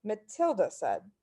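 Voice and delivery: usual speaking voice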